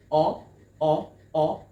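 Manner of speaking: "oh" as three loud, separate "uh" vowels; short U sound. Shown as a demonstration. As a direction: repeat "oh" like the start of umbrella. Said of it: "the short U sound".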